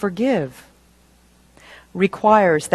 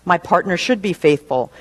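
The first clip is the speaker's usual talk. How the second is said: normal talking voice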